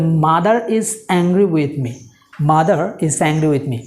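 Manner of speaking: very slow, carefully spaced words; dictation-slow teacher read; slow model sentence. Shown as a demonstration. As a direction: say it slowly so learners can follow. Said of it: "teacher models the sentence twice".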